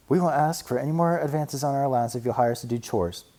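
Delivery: high, eager little cub; sister cub voice. A narrator's reading. Sister cub pleading with Papa for money, high and eager. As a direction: play an eager young cub.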